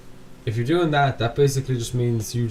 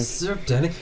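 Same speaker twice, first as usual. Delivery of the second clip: put-on higher, jokey street-accent greeting; teasing a friend with an overdone street-accent greeting; 7 semitones higher, slower than usual. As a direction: send up the accent as a bad caricature. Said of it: "most, like, fucking racist accent".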